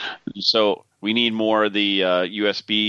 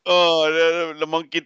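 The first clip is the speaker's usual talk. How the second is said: ordinary delivery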